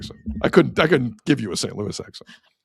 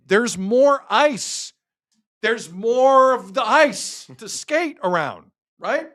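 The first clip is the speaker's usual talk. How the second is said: much higher pitch, words drawn right out; slow, high incredulity; 12 semitones higher, much slower than usual. Real, not acted. Host ranting about the three-on-three overtime lineup, slow high incredulity. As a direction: spell it out very slowly in a high, incredulous voice.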